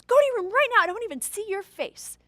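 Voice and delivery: high, loud mock yell; retold father ordering her away; angry dad. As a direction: cut her off sharply. Dad shouting back.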